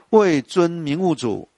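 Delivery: slow, measured verse reading; poem recitation. Shown as a demonstration. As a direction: savour each syllable of the line. Repeats line slowly. (慢速吟诵诗句)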